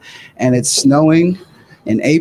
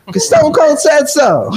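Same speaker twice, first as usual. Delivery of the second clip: mock rally shout; 10 semitones higher, slower than usual. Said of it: "they're like / he's like".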